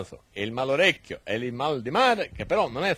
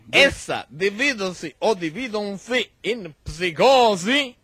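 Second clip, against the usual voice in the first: pompous lecturer; 6 semitones higher, 3 dB louder than usual. Acted. Mock-learned lecture, voice raised.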